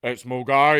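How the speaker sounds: loud Scottish "It's mo guy" with the name called out; train passenger's call. Retold or imitated. Passenger on the train echoing the bad announcement, loud call. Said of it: "some person that would go".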